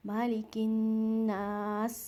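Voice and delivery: मालिकिन्नास drawn out, nasal न; slow recitation. Shown as a demonstration. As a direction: stretch मालिकिन्नास. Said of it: "दो second आपने इसको लंबा करना है".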